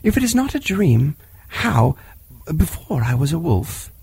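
put-on high, eager boy's voice; a fearless wild boy remembering; young boy voice. Mowgli answers Bagheera.

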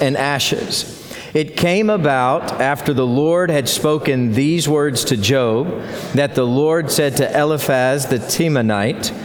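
congregation reading aloud together; many voices in unison. Real, not acted. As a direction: read it together as one body. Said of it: "we'll read it aloud together".

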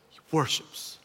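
whispered in awe; soft awed whisper; hushed whisper. Real, not acted. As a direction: breathe the words reverently.

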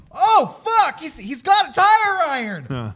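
high, loud panicked yelp; hammed-up high-pitched alarm; mock panic. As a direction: imitate kids freaking out. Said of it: "they're all like".

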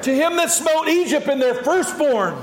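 high, full-voiced psalm proclamation; exuberant praise shout. Shown as a demonstration. Preacher calling out psalm verses to the congregation, exuberant praise shout. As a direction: call out each verse with fervor.

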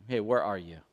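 low, quiet voice reaching out; gentle calling. Acted. God calls to the hiding man, softly and low.

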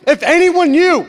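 loud, high mock-panicked shouting; terrified outburst. Youth pastor reliving what he overheard, terrified and loud. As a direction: hammer each phrase in hammed-up alarm. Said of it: "I'm terrified by the things".

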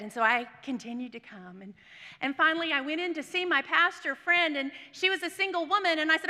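ordinary talk

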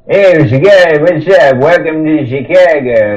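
a cheery local greeting; mock Chicago accent. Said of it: "Chicagoan here".

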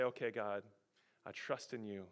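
quiet surrender. Acted. Believer surrendering to God.

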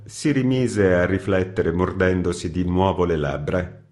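ordinary delivery, nothing marked

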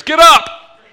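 full-volume parent's shout upstairs; sudden high-pitched yell. Acted. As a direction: holler it up to the bedrooms, high and loud. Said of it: "Any of you yelled upstairs".

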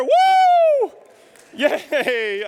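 high, loud self-cheer; whooping at a shot that only hit the paper; mock celebration. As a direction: cheer like you won a prize.

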